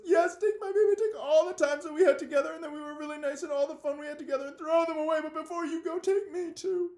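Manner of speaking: high, loud, sobbing-laughing operatic wail; melodramatic heroine. Acted. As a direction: overplay the tragic heroine's farewell. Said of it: "and she says".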